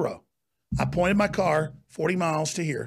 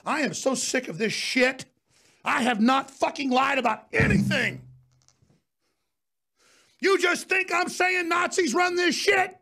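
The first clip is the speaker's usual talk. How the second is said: swearing tirade; 8 semitones higher than usual. Real, not acted. Angry cursing rant.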